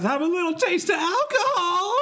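very high, hollering rock-frontman stage banter. Acted. Giving listeners a clue who it is, hammed-up rock singer impression. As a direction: wail it to an arena crowd. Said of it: "is that a [name]?".

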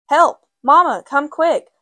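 high, loud panicked cry for mother; scream for help. A narrator's reading. Girl bursting in, screaming for her mother. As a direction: yell it, terrified. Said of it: "Sylvia Patterson screamed".

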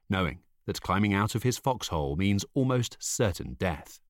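ordinary delivery

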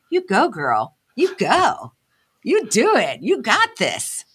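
lightly voiced inner cheerleader; a hint of a friend cheering you on. The inner voice now cheering her on, lightly encouraging. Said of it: "now that voice is saying".

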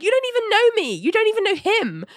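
very high, mock-indignant retort; indignant protest. Ali arguing with her therapist, indignant.

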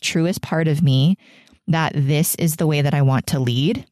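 usual speaking voice

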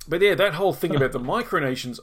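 ordinary talk

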